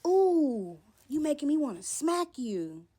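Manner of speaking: high, loud menacing "Ooh" then a threat; threatening. Retold or imitated. Boyfriend threatening to smack her, loud and high menace. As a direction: sound like he's about to hit her.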